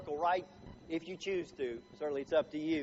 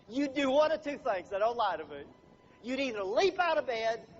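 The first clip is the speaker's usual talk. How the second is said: ordinary talk, nothing marked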